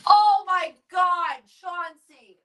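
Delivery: high, slow cry of pain; real pain after a knock to the face; high slow pained Oh my God. Real, not acted. Dog head hit her face.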